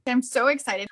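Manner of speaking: usual speaking voice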